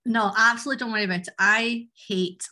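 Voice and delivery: ordinary talk, nothing marked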